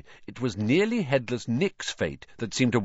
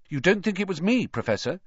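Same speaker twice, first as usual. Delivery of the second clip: high, tentative questioning; boyish, hopeful question; 9 semitones higher, faster than usual. A narrator's reading. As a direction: ask hoping to be believed. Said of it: "Harry repeated hopefully".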